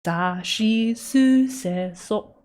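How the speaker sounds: "Sashi su se so" spoken slowly, syllable by syllable; slow Japanese drill for the camera; syllable practice. Shown as a demonstration. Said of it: "We're going Japanese".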